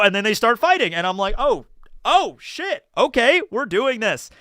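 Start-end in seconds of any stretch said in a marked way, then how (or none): 1.4-4.3 surprised. Retold or imitated. DM caught off guard by fight.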